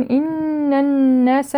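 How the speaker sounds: slow, sustained hum on both ن in إن الناس; full doubled nun. Shown as a demonstration. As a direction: hold each doubled n of إن الناس fully. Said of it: "نون مشددة أكمل ما تكون".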